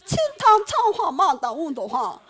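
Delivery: slow, loud singsong fake Chinese; mock Chinese tongues. Shown as a demonstration. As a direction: show off comic Chinese-sounding syllables, drawn out. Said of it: "중국어로 막 방언을 하죠". (크고 느린 중국어 흉내)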